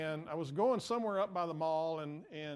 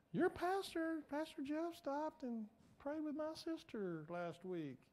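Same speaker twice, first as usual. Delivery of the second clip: much higher-pitched voice for another person; imitated friend; 11 semitones higher, 4 dB quieter, slower than usual. Friend telling someone about the pastor's prayer, imitated voice. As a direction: put on a high voice for a friend.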